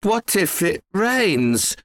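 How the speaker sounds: put-on high, drawn-out boyish worry; fretful young son, voice lifted; worried question. A narrator's reading. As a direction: ask anxiously and slowly. Worried boy pleads with father.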